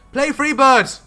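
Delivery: yelled request, high and loud; shouting. Retold or imitated. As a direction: shout it like a heckler at a gig.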